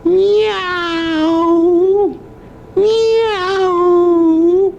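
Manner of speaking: high, loud meowing; cat meow. Shown as a demonstration. Grandpa meowing for his grandson. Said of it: "Oh, I know".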